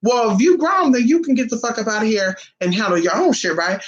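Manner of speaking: high, mocking oh really from mom; sarcastic mom calls his bluff; mother mocks her son's grown-man claim, sarcastic; mom's mocking retort. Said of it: "my mom said".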